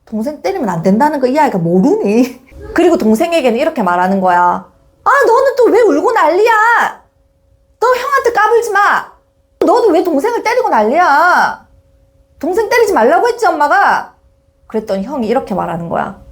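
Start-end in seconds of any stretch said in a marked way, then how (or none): 5.0-14.1 high, exasperated acted reprimand; nagging mom with raised pitch; scolding mother. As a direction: tell off both children at once. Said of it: "훈계와 지시와 명령과 나무란만".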